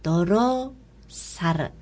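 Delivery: ドロサル said slowly with rolled R, Mongolian style; slow careful ドロサル with trilled R; Mongolian pronunciation. Shown as a demonstration. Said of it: "七月は、".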